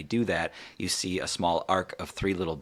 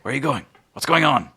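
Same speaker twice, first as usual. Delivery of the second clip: higher, louder, urgent questions; urgent questioning; 8 semitones higher, 8 dB louder, faster than usual. Acted. Waking captive to departing captors, urgent questioning. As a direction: call out urgently after people leaving.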